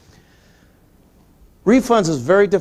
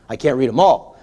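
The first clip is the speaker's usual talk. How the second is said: ordinary delivery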